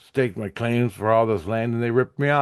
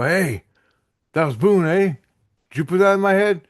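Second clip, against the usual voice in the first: excited question; 10 semitones higher, 4 dB louder than usual. Retold or imitated. Asks priest about the dream.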